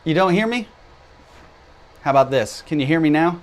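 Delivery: calling out. Real, not acted. Calling out over a bad connection.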